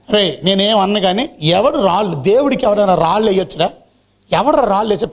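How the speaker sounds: ordinary talk, nothing marked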